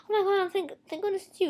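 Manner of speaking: high-pitched imitation of relief; mock relief. She finds her lost brother, mock relieved. Acting out relieved sister in film.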